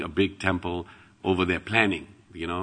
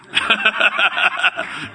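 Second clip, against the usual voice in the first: high, loud burst of laughter; hearty laugh; 12 semitones higher, 6 dB louder than usual. Real, not acted. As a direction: laugh out at your own joke. Laughs at his own prayer.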